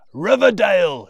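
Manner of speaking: loud, breathy shout of the name; old man shouting his birthplace. Acted. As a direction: call it out over the roar. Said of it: "shouts Mister Solomon".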